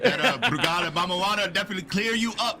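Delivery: high, loud voice breaking into laughter; laughing. Real, not acted. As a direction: crack up as the joke lands. Joking with colleagues while laughing.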